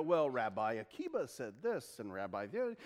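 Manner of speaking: low, quiet mumbled recital; droning citation. Retold or imitated. Preacher mimicking rabbis citing rabbis, droning. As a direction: rattle off references under your breath. Imitates footnote-citing teachers.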